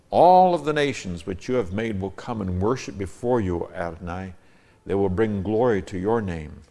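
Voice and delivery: declaimed psalm with strong, loud openings; forceful, measured recitation; emphatic scripture reading. Acted. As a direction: proclaim the verse to God. Reads own psalm translation.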